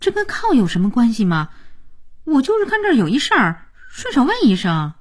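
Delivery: puzzled, aggrieved comeback; baffled and wronged. A narrator's reading. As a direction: protest as if unfairly blamed. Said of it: "这话让佳期既莫名其妙又委屈".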